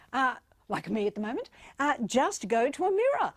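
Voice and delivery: usual speaking voice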